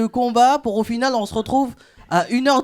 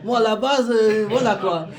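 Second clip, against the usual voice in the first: mock flustered boyfriend; 5 dB louder, slower than usual. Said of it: "Il a réagi en mode".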